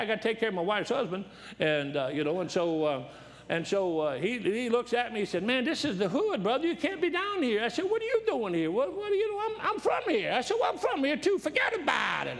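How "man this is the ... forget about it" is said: much higher, hammed-up New York street talk; preacher answering a stranger in a mock New York accent. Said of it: "I had to talk like I was New York".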